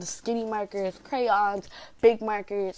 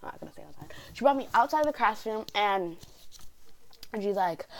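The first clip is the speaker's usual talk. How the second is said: usual speaking voice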